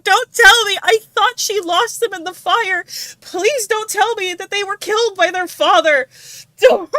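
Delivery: high, loud anguished begging; desperate pleading. Real, not acted. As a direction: plead as if it can be stopped. Begging the story not to be true.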